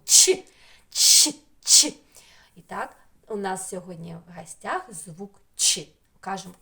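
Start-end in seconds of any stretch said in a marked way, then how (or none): 0.1-1.9 Ч as short, loud, separate bursts; isolated Ч sound. Shown as a demonstration. Teacher letting children hear the sound, isolated Ч. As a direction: fire off Ч on its own, sharply. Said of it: "Вушком своїм звук".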